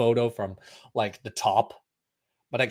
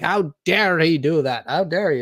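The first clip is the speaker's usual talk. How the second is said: slightly higher, indignant mock voice; mock outrage; 6 semitones higher, 5 dB louder than usual. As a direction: play scandalized onlookers.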